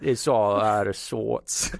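slow, lazy put-on Cajun accent; mock Cajun accent. Teasing the co-host in a slow, playful mock Cajun accent. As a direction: drawl it like a bayou local. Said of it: "you sound like a, from the bayou".

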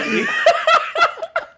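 high-pitched giggle burst; high squealing laugh. Real, not acted. Breaks up laughing.

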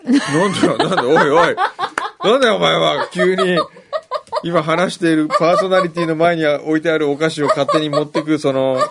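laughing while loudly complaining; half-shouting through laughter; laughing protest. Real, not acted. Snack taken mid-show, protesting.

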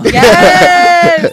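thrilled yell of yes; excited shout. Real, not acted. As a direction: shout it out in delight. Celebrating getting verified.

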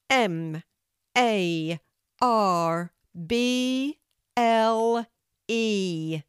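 letters called out loud, long and drawn; loudest voice. A narrator's reading. Kelty spells for the tree in her loudest voice. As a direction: belt out each letter slowly, one by one. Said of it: "said in her loudest voice".

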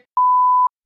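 shrieking laugh; high squeal of laughter. Real, not acted. Laughing at a tweet about Serena's name, a high shriek. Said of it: "It made me laugh so hard".

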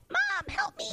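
very high, tiny pleading voice; tiny squeaky voice. Retold or imitated. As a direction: squeak it like a helpless little plant. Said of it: "the poor succulent's like".

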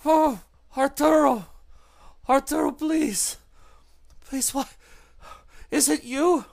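high, loud, desperate pleading to Arturo; a trapped woman begging her captor; high frantic cry from inside a trunk; frantic voice in the box. Acted. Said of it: "and you hear".

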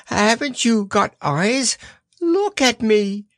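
slow, high exasperated squeal; shouted. A narrator's reading. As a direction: plead to be seen in a high Piglet voice. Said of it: "shouted Piglet".

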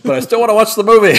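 loud, high hammed-up whine; exaggerated pleading shout; mock wailing protest. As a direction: cry it out like a jilted moviegoer. Acting out loud public excuse.

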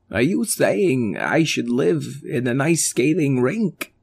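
a gently downcast alien; Chris alien voice. Acted.

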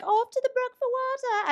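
high, put-on British accent. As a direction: chirp it in a mock British accent. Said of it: "I don't know why I made him in-, British".

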